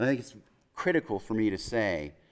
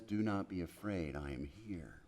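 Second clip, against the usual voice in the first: quiet, gentle comfort dropping much softer; soft reassurance; 5 semitones lower, 12 dB quieter than usual. Acted. God comforting a frightened person, quiet and gentle. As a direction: ease the words out softly to calm someone. God speaking to fearful people.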